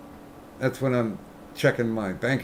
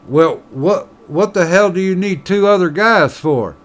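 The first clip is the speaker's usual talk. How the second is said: louder, higher puzzled miner; lightly voiced miner, baffled; miner in a joke; 6 semitones higher, 8 dB louder than usual. Miner asking the bartender why, louder and higher.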